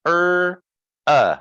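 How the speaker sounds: "Er" high and held, "uh" dropped and punched; isolated vowel sounds. Shown as a demonstration. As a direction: sound out "Er", pause, then "uh". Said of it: "the vowels are er and uh".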